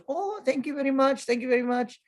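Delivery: high-pitched mock lady voice; put-on grateful lady. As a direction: squeak out thanks in a put-on high voice. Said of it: "I don't know why I did that voice".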